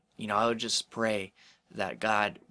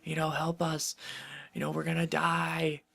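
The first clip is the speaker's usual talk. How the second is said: higher-pitched imitation of frightened disciples; retelling panicked cries in a raised, mock-scared voice; 7 semitones higher than usual. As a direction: play the disciples freaking out, half-joking. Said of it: "they're freaking out and like".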